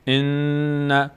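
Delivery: ان with a long humming ن; doubled nun on ان. Shown as a demonstration. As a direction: hold the doubled ن in ان. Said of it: "اینجا تشدید داریم روی نون".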